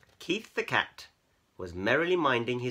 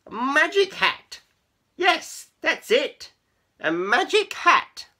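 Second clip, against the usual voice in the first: high, loud exclamations; triumphant excitement; 12 dB louder, slower than usual. Acted. Keith finding his excuse for the cats, triumphant cry. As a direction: cry it out as a sudden brainwave.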